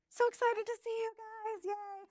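put-on high, bubbly greeting to little kids; preschool teacher voice. Shown as a demonstration. Teacher greeting her pretend class, high and gushing. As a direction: sing it out like welcoming four-year-olds. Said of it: "let's pretend everybody in here is in my class".

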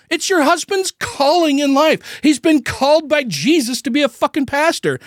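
high, heated disbelief at the first lady; exasperated rant. Retold or imitated. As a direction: vent at her like she's making you ill. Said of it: "And I'm like".

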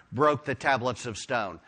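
normal talking voice

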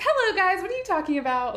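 high, loud sing-out greeting; syrupy mock-innocent kid voice; sweet little girl. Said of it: "Like, all sweet".

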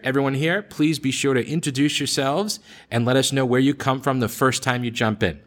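ordinary talk, nothing marked

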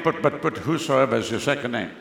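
ordinary delivery

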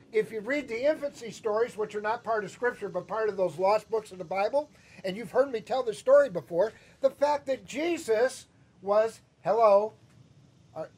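ordinary talk, nothing marked

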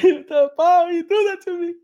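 very high, loud disbelieving shriek; hammed-up shocked kid; shocked outrage. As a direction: squeal it in total disbelief. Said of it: "I was like".

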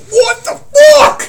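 very high, drawn-out shout; loud, stretched, high-pitched freak-out; panicked yell. Retold or imitated. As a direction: re-enact terror at full volume. Acted panic at the roaring scream.

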